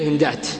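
"هندات" with final t sounded; pause on ta. Shown as a demonstration. Teacher showing the common form, final t. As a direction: end "هندات" on t, not h. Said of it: "الكثير".

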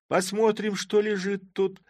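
high, loud excited shout; shouting girls. A narrator's reading. Girls crowding the sack, shouting high and loud. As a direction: call out eagerly. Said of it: "закричали все".

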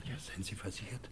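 hushed whispered promise; whispered reassurance. Acted. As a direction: swear secrecy under your breath. Promising to keep a secret.

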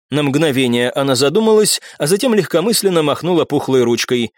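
plain reading voice

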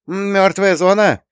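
high, loud, slow nervous quip. A narrator's reading. Terentyeva echoes the dead-zone remark, nervous and high-pitched. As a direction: force a smirk with a failing voice. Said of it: "нервно ухмыльнулась Терентьева, но голос подвёл".

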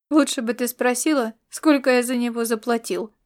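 usual speaking voice

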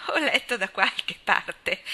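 slow, amused reading through a laugh; a touch of laughter, understated. A narrator's reading. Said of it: "disse Anna ridendo".

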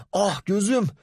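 high yelp after a punch; pained cry. A narrator's reading. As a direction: cry out, hit in the eye. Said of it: "Engin'in gözünün üstünde patladı".

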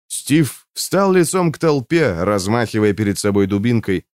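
plain reading voice

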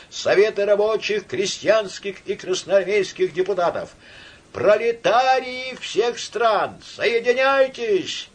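high declaimed rally slogans, slowing down; shouted slogans. A narrator's reading. Orator to workers of all lands, proclaiming. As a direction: proclaim it to the whole square.

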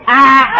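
high startled cry; startled yelp. Acted. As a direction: yelp as the spoon goes in. Tricked into swallowing medicine.

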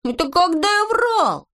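high, drawn-out incredulous question; slow high-pitched disbelief; indignant surprise. A narrator's reading. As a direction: protest in a high, astonished voice. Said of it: "удивился Незнайка".

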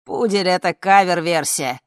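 moderately loud, indignant cry; shouted protest. A narrator's reading. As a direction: protest over the crowd. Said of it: "закричал С".

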